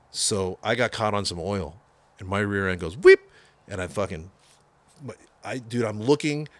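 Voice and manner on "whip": sharp high whip noise, much higher and louder; skid sound effect. Retold or imitated. Car fishtailing on oil, sudden high whip sound. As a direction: make the car whip around.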